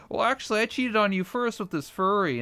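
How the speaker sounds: a hammed-up smug confession; smug girlfriend. Girlfriend on a talk show confessing to her boyfriend, high mock voice. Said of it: "then the girl's like".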